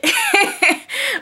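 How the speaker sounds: high-pitched burst of laughter; amused laugh. Real, not acted. Vlogger mocking a bad audition, amused laugh. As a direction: crack up briefly.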